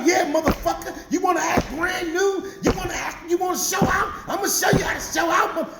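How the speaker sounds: high-pitched, heated taunting; mock furious tirade, voice way up high; raging wife. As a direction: taunt him as the wife mid-beating. Wife yelling while kicking him.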